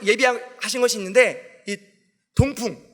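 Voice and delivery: ordinary talk, nothing marked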